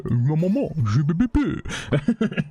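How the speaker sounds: lazy, slowed mock French gibberish, lightly voiced; sloppy French babble. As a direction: mumble loose French-sounding nonsense, slowing down. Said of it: "relaxed and sloppy version of French".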